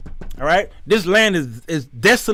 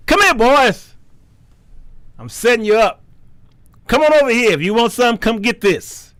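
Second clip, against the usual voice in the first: raised, taunting summons; calling out; 5 semitones higher than usual. Acted. God setting enemies up, higher calling out. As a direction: beckon enemies into a trap. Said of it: "He's talking to the enemies".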